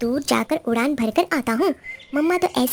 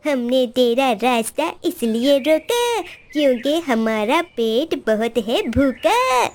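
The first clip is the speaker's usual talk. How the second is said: bully crow. Acted. Crow threatens.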